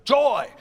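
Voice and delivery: "joy" punched loud and high; accented staccato. Shown as a demonstration. Choir director demonstrating the accent, loud staccato "joy". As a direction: hit "joy" as a stressed staccato. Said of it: "like a stressed staccato, an accented staccato".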